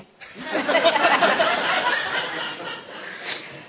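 high embarrassed laugh; self-mocking laugh. Real, not acted. Pastor laughing at his own grumpiness, high laugh. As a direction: laugh at yourself.

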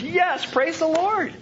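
high, drawn-out cry of praise; jubilant, high-pitched hallelujah style; exultant praise. Acted. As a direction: sing out the praise, long and high. Exultant answer to a complaint.

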